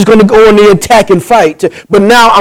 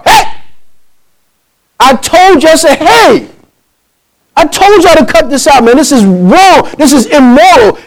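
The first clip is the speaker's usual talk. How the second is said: retold angry confrontation; 6 semitones higher than usual. Confronting the couple outside the house.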